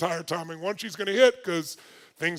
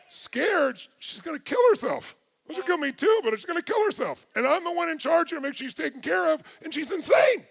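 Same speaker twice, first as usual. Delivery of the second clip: high, loud, agitated outburst; worked-up exasperation; 9 semitones higher, 5 dB louder than usual. Retold or imitated. Husband scared she'll kill herself, worked up. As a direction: relive the alarm at a high, exasperated pitch. Relives fear and anger at wife.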